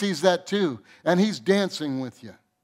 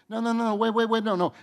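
higher, urgent "no, no, wait"; urgent interruption; 6 semitones higher, faster than usual. Real, not acted. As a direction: jump in to stop them. Pastor cutting off the excuse.

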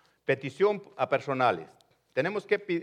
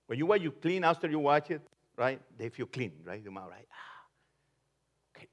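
ordinary delivery

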